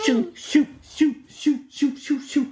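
high, airy whoosh sounds; whooshing bird. A narrator's reading. The blue tit zigzags through the cheese arms, high whooshes. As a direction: zip past like a darting bird. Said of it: "zigzaggede ind og ud".

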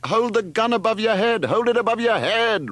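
high, loud urgent roar. A narrator's reading. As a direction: roar an urgent order across the distance. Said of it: "roared Leslie".

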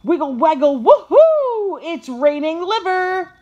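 loud, high-pitched whoop of joy; ecstatic. A narrator's reading. Puppy as the treat bag flies up, ecstatic. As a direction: cheer at full tilt.

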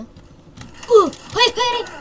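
high, loud panicked no; panicked cry. Real, not acted. Gamer dying in a match yells in real panic. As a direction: yelp it out as you go down. Getting shot in the game.

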